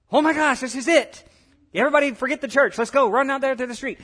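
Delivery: loud, high burst of excitement; high-pitched thrilled cry to rally everyone; excited outburst. Acted. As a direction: shout it like the big moment just arrived. Acts out excited crowd at arrival.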